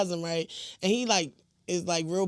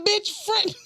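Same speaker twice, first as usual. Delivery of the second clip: much higher, exasperated outburst; exasperated snap; 11 semitones higher than usual. Retold or imitated. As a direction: burst out fed up. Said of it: "I said".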